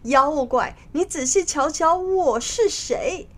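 defiant call climbing high; mild shout. Acted. Monkey King revealing himself to the demon minister, mildly shouting. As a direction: challenge the demon, pitch rising on the question. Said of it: "对着国丈大喊".

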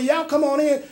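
mock welcome. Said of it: "your soul opens the door and say".

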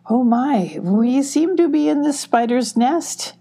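high, nervous dread. A narrator's reading. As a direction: speak up high with an uneasy shudder. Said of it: "said nervously with a shudder".

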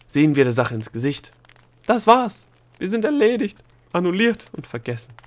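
loud, high declaration of defeat; fatalistic outburst. A narrator's reading. Gnome gives up while sinking, shouting fatalistically. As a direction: shout out that all is lost.

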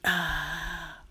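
long satisfied "ah" after a drink; refreshed sigh. Acted. Marcia gulping her pineapple soda, refreshed sigh. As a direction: let out a thirst-quenched exhale.

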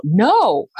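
drawn-out, high yelled refusal; re-enacted cry of refusal; retold yell. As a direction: yell it out, pitch leaping up. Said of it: "And I yelled out".

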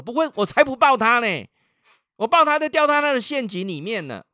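very high, loud mock indignation; mother's stubborn refusal. Mother refuses to hug, retold. (模仿妈妈高声拒绝)

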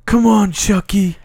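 high, drawn-out little cartoon voice; cartoon Tommy voice. Host as Tommy comforting Chucky from Rugrats, high cartoon voice. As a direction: put on a high cartoon voice to reassure a pal. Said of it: "Love that voice".

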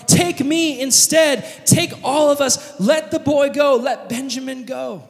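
high, pleading offer of himself; Judah pleading. Retold or imitated. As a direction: beg to take his place. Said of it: "Judah says".

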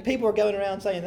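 usual speaking voice

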